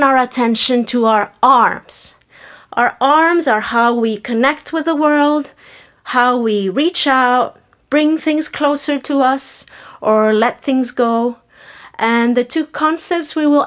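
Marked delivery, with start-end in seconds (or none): none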